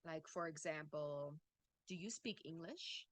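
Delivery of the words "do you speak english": "English" lifts in pitch at the end; rising yes-no question. Shown as a demonstration. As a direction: ask it with a rise on ENGLISH. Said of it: "hear the intonation rise a little bit".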